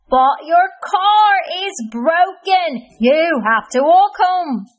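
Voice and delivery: high, loud, exasperated grump; frustrated Cranky Kareem. Acted. Cranky Kareem berating Hamdi from the bush, high frustrated squeal. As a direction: squeal it out, fed up and disbelieving.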